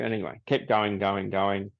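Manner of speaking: usual speaking voice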